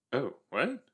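ordinary talk

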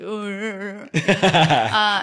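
a lightly voiced laid-back guy, drawn out yeah; half-laughing mock husband, easygoing. Wife does her husband's voice for the hosts, laughing. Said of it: "that's my husband voice".

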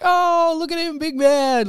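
very high, loud drawn-out teasing whoop; joking jeer. Retold or imitated. Mobster teasing the kid who stood up, high joking jeer. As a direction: whoop it up, imitating a mocking crowd. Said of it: "like, just joking around".

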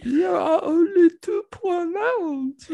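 put-on very high, slow, whiny French accent; strained, whiny Kermit-like French voice; French Jordan Peterson. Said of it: "that's a tough impression".

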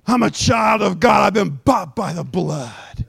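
slowing, drawn-out proclamation; triumphant warrior. Acted. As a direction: declare it like a victorious swordsman, falling in pitch. Believer declares victory over devil.

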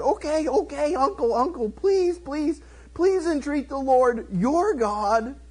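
high, frantic begging; mock pleading. King begging the man of God, mock pleading.

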